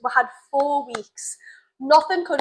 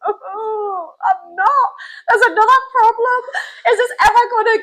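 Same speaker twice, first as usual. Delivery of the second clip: high, mock-tearful complaining; overdramatic, high and loud woe; dramatic despair; 9 semitones higher, 4 dB louder than usual. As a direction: wail it like a hammed-up meltdown. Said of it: "I was so dramatic as well".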